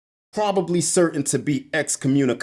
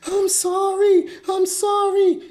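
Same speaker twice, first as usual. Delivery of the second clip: very high, wailing mock scream; shrieked falsetto impression of a victim; imitated screaming; 4 dB louder than usual. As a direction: scream it out as a terrified woman pleading. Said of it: "she's just screaming".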